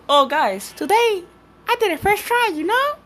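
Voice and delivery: high, mock-cheery YouTuber boast; mock a fake-humble YouTuber. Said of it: "And I'd be like, in my video".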